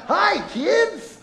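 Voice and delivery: high, bright-eyed Barney hello. Shown as a demonstration. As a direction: beam it out like a purple dinosaur on TV. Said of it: "I gotta work on my Barney voice".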